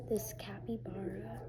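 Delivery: soft whispered name; hushed ASMR naming. Real, not acted. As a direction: whisper the item to the camera.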